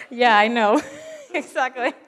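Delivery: loud, high giggling agreement; laughing. Real, not acted. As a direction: laugh through the words. Laughing at their naive delight.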